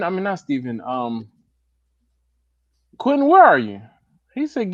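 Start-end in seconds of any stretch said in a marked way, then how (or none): 3.0-3.8 high, loud call across the room. Real, not acted. Host calling for a missing friend, loud and high.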